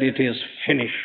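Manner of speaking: soft, fading last breath of the Lord; hushed dying words. Retold or imitated. Preacher quotes the dying Lord on the cross, hushed and fading. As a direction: let it fall away almost to nothing.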